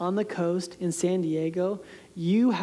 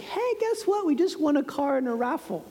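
much higher, thrilled announcement; excited mom; 7 semitones higher than usual. Retold or imitated. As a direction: break the big news gleefully. Mom announces raffle win.

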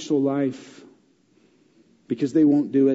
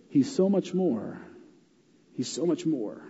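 dropping to a near whisper; quiet awe, almost breathed; hushed; 2 semitones lower, 7 dB quieter than usual. Acted. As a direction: let it fall soft and reverent. Softened reveal of sign's meaning.

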